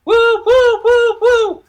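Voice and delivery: very high, loud woo-woos; repeated falsetto cheers; forced hype whooping. Pretend. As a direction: whoop shrilly to pump up the energy. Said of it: "Trying to keep the energy up".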